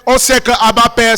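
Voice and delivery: loud, ringing sermon delivery; full-force preaching. Acted. Pastor exhorting the congregation, in full preaching voice. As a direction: preach it out to the whole church.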